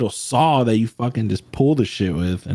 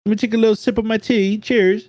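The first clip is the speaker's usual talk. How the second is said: higher, posh-playing British voice; mock British accent; 10 semitones higher than usual. Tree cutter sipping tea in the palm, mock British accent. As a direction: lampoon a tea-sipping Englishman. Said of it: "What do you think he's saying before that picture?".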